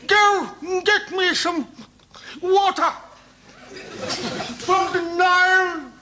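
thirsty colonel's order. Retold or imitated. Colonel to his batman, slow and parched. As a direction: drag it out like a parched officer.

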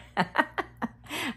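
high bright laugh; a quick amused giggle. Real, not acted.

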